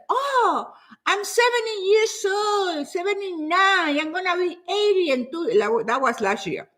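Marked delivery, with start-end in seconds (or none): 0.1-5.6 high-pitched, hammed-up whine of excuses; mock age complaint. Client making excuses to the coach, high mock complaint. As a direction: mimic someone protesting their age, pitched well up. Mimics excuse about being too old.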